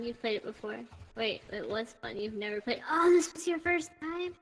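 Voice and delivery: ordinary delivery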